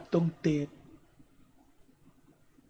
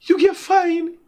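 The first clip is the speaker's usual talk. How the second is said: put-on high, delighted "Oh God" from the girl; mock amazed girl; 13 semitones higher, 4 dB louder, slower than usual. Girl at the gate admiring his suit, high and delighted. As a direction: gush over him like the admiring girl. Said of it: "the girl say".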